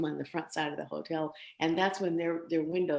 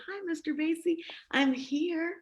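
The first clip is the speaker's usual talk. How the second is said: put-on eager young self; 12 semitones higher than usual. Eager greeting to [name] retold.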